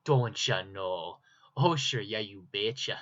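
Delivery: loud, slow hammed-up Minnesota accent; mock his own Minnesota accent, broad and loud. Artist mocking his own home state for viewers, hammed-up Minnesota accent. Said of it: "I'm from Minnesota".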